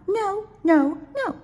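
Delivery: high squeaky no, no, no; shrill little owl protest; child voice. Acted. Child refusing bedtime.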